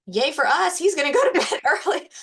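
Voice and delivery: high, loud cheer with a laugh; mock celebration. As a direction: cheer like a delighted parent. Parent cheering child's early bedtime.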